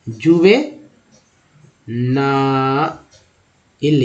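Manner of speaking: Juvenile stretched out very slowly, syllable by syllable; slow syllable demo. Shown as a demonstration. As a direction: drag juvenile out for the learners. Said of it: "next है".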